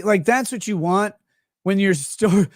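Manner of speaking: ordinary talk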